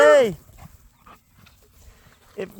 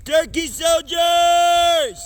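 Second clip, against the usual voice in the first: long drawn-out call, slow; calling from far away; 3 semitones higher, slower than usual. Real, not acted. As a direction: holler it slowly across the yard. Calling the turkeys in.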